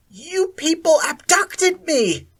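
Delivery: high, loud slow shout. A narrator's reading. Akira shouting at Sally Ann, loud. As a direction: scream the accusation. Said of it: "Akira shouted at Sally Ann".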